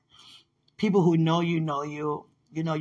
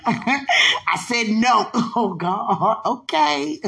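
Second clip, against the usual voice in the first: loud, drawn-out protest breaking into laughter; laughing protest; 5 semitones higher, 9 dB louder, much slower than usual. Real, not acted. Speaker playfully argues over getting their way, laughing protest. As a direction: protest loudly while cracking up.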